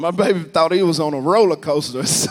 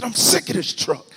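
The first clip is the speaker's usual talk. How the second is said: retold exasperated complaint, raised pitch; mad; 8 semitones higher, 4 dB louder than usual. Driver slamming the truck door, mad retold complaint. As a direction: grumble it louder and higher as you go. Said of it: "I'm getting mad, man. I slammed that door".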